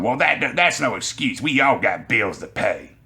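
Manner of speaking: mock an annoyed customer without shouting; pissed-off older woman. Older customer dismissing the cashier's excuse, imitated pissed-off voice. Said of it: "The older woman gets really pissed off".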